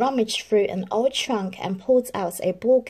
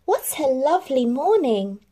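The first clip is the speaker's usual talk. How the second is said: bright joyful exclamation, a little louder; exclaiming; 6 semitones higher, 4 dB louder than usual. A narrator's reading. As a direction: greet the morning with delight. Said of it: "and exclaimed".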